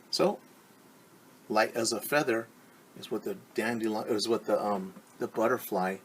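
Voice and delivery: ordinary delivery, nothing marked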